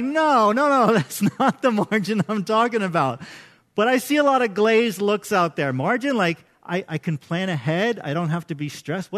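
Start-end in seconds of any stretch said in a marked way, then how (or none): 0.0-3.2 playful no-no-no, laughing as you set them straight; mock exasperated protest. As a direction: brush off the wrong answer with a chuckle. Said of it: "And I'm like".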